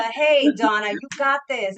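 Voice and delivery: loud cheering encouragement; cheerleader voice. Retold or imitated. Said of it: "be our own cheerleader".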